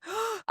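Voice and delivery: high excited gasp; sharp gasp. Retold or imitated. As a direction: gasp at the cliffhanger. Reacting to the cliffhanger.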